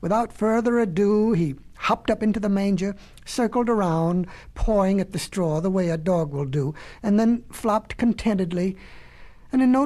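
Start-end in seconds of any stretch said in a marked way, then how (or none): none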